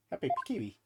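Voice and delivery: usual speaking voice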